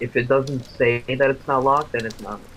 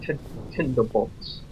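slow, drawn-out British accent; put-on British pronunciation, stretched out; slower than usual. Shown as a demonstration. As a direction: demonstrate the word in a British accent. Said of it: "say it with a British accent".

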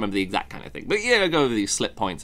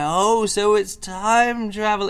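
slow dawning realisation; 8 semitones higher, slower than usual. Retold or imitated.

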